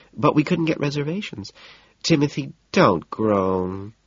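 high, fluttery society hostess voice; fretful hostess. A narrator's reading. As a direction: coo apologetically like a fussy hostess. Evelyn voiced in narration.

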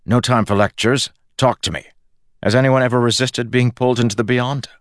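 a hint of a brisk, insistent schemer; lightly voiced impatient Kelsier. Kelsier cutting off God's lecture, mildly impatient.

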